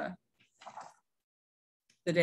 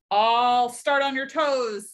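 loud, slow, raised threat; bold threat; 5 semitones higher, 5 dB louder, slower than usual. A narrator's reading. Dot threatens the bigger bear, bold. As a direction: stretch it out menacingly.

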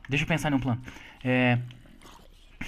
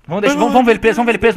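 excited urging; 4 semitones higher, 7 dB louder, faster than usual. Real, not acted.

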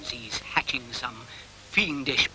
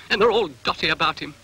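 usual speaking voice

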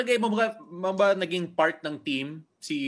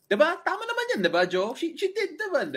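teasing high voice; faster than usual. Acted. Teasing host seeking agreement.

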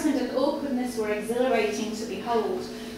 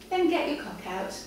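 a lightly raised, bolder lady's voice; Victoria's commanding voice; 5 semitones higher, 4 dB louder than usual. Acted. Victoria ordering her kneeling suitor, lightly voiced and bold. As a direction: command him, a touch higher.

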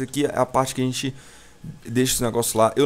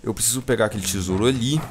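normal talking voice

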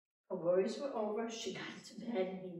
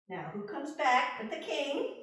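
plain reading voice

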